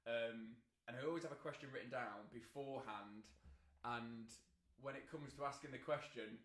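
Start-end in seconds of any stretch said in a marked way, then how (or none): none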